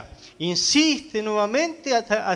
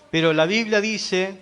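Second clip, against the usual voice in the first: ordinary delivery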